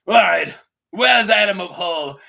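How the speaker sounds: loud, demanding dragon quote; Sea Smoke. Retold or imitated. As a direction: call out loudly for someone you want. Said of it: "So Sea Smoke is like".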